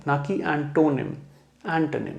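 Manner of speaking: ordinary talk, nothing marked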